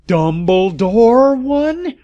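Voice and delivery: high, loud, slow shocked gasp. Acted. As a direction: gasp the name out in disbelief. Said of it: "we all gasped".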